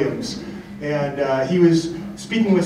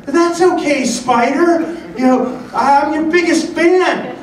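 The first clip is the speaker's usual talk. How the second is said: put-on high, loud adoring little kid; Zach; 12 semitones higher, 4 dB louder, slower than usual. Little kid reassuring his favourite player, high loud kid impression. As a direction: gush in an imitated small fan's voice. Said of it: "this little kid".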